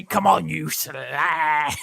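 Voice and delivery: slow, rough English geezer bark; Brexit geezer voice. Acted. Jokingly shouting at wife in English geezer accent. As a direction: play a lairy English bloke. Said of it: "that Brexit geezer voice".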